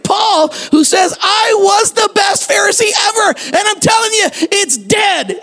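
high, ringing preacher's shout; fiery preaching. Acted. Pastor exhorting the congregation, fiery preaching.

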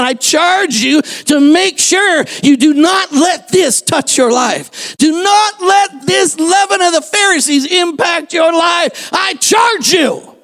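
slow, high, ringing shout of warning; urgent preaching. Acted. Pastor warning the church about the Pharisees, slow ringing shout. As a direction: charge them like a solemn command.

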